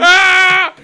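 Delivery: high, loud sustained yell; celebratory scream. Real, not acted. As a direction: let out a long excited scream. Cheering in turn.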